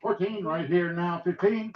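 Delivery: rapid rhythmic auction chant; auctioneer. Acted. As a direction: roll the numbers like a bid caller. Auction bid calling.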